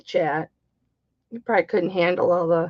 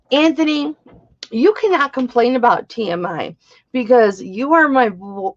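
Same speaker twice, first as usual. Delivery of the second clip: ordinary talk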